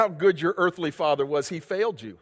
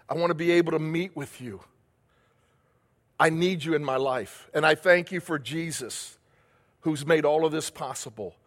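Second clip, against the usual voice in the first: softer, lower modelled prayer; hushed sincere prayer; quiet prayer; 4 semitones lower, 3 dB quieter than usual. Acted. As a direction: lead them gently in prayer.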